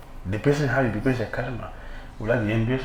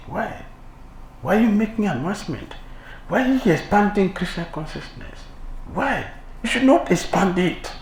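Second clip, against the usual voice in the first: voicing a jealous devotee, higher; petulant envious whine, pitched up; envious rival; 8 semitones higher than usual. Preacher mimics a jealous devotee, higher and whiny.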